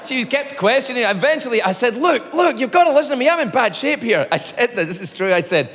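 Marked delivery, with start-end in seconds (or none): 1.9-4.3 raised, insistent plea; urgent pleading. Retold or imitated. Sick caller to receptionist, urgent pleading. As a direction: beg her to listen, pitched up.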